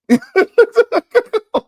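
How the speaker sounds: loud, high burst of laughter; real high-pitched laugh; cracking up. Real, not acted. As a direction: crack up at your own jab. Cracks up at own Dooley jab.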